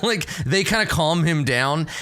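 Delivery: ordinary delivery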